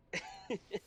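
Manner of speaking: high-pitched burst of giggles; giggling. Real, not acted. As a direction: giggle helplessly. Recalling getting high and giggling.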